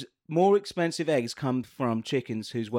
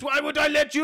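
put-on high shouting Russian accent; 11 semitones higher than usual. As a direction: yell it in a hammy Russian accent. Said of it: "What is my voice?".

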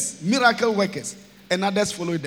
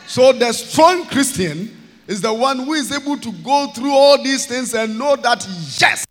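preaching at full force; 3 semitones higher, 6 dB louder than usual. Acted. Preacher assuring his congregation God is with them, full-force preaching. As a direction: proclaim it to the whole congregation.

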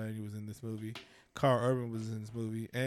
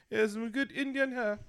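a quick, hammed-up Indian accent pitched higher; bad Indian accent; 10 semitones higher than usual. Mocking his own attempt at an Indian accent for the co-host. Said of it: "that's a bad Indian accent".

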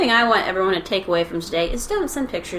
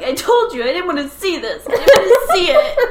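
high-pitched imitation of a complaining friend; high, laughing retold objection; mock complaint; 11 semitones higher, 7 dB louder than usual. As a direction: whine it in mock protest through laughter. Imitating friend's complaint about the movie.

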